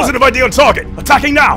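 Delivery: military callout. Acted. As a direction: shout it like a squad leader on comms.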